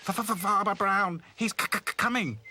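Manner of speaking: high, slow scared stutter; stammering. Acted. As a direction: stutter it out in fright. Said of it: "stammered Hi-Fi".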